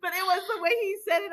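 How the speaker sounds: ordinary delivery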